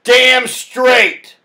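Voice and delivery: high loud emphatic snap; loud retort. Retold or imitated. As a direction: punch it out triumphantly. Retorting to girlfriend.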